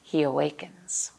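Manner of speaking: gentle picture-book narration with playful questions; reading to children. Acted. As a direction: read it like story time for little kids.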